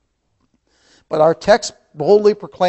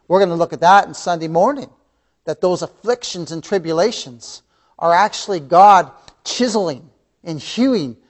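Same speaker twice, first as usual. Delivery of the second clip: usual speaking voice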